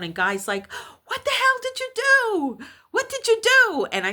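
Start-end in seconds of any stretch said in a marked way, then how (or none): 1.1-3.9 high, loud frantic screech; imitated hysterical caller. Hysterical friend confronting her on the phone, imitated screech. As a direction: screech it in mock panic, demanding an answer. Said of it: "he's hysterical on the phone".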